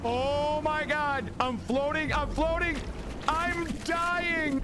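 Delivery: frantic high voice; panicked but laughing; high panicked squeal. Real, not acted. Panicking in game.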